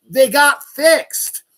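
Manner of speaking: slow, high, loud punch; emphatic shout. Real, not acted. Ranter defending the president's fixes, shouting. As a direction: drive each word home angrily. Hammers rebuttal to critics.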